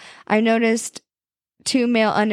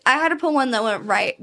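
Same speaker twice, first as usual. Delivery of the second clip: usual speaking voice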